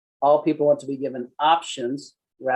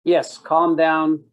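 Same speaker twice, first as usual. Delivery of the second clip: slow, loud "Yes, calm down" with stress on Yes; emphatic; 2 semitones higher, 5 dB louder, much slower than usual. Real, not acted. Instructor stressing Yes for the class. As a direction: land it slowly. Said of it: "emphatic lesson point".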